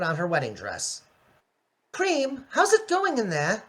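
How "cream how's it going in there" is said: high, refined Rarity. Acted. Rarity calling through the door to Cream, high and refined. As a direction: lift the voice up into a posh pony. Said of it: "I haven't voiced her in a while".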